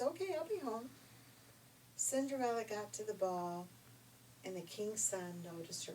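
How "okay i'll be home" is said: put-on higher, eager young girl; high girlish voice. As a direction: answer brightly like a girl. Cinderella answers brightly.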